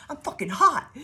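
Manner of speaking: high, loud and laughing; giddy loud boast, laughing; laughing self-brag. Pretend. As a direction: crow it at the mirror through a laugh. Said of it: "She's like".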